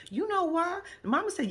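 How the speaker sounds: usual speaking voice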